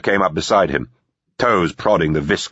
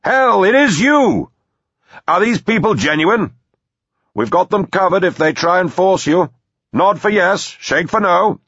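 high, raised distant shout; blaring voice; 12 semitones higher, 4 dB louder than usual. A narrator's reading. Manor voice questioning Victor about strangers, blaring. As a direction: blare it out across the water. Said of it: "the voice blared".

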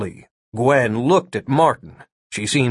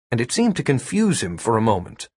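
plain reading voice

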